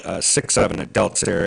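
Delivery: normal talking voice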